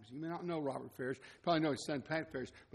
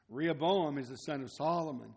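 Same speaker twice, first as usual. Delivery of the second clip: ordinary talk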